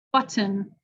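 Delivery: "button" with a clearly released T; careful crisp T; regular T. Shown as a demonstration. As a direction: say the T fully. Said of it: "regular T".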